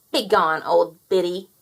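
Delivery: loud, scornful brush-off; rude dismissal. Acted. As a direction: shoo her away. Fowler dismissing old woman.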